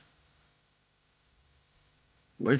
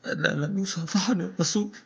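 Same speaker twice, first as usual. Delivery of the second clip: higher, anguished lament on يصافحني; anguished cry; 7 semitones higher than usual. Acted. Repeated line, rising emotion.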